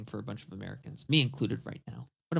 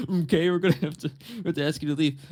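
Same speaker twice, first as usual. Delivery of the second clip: loud, raised cartoon voice of authority; Mister Mackey; 5 semitones higher, 8 dB louder than usual. Store worker asking Cartman to leave, cartoon impression. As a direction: tell him off in a hammed-up cartoon voice. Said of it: "Mister Mackey is working at Publix now".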